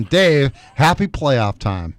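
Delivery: ordinary delivery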